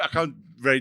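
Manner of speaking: normal talking voice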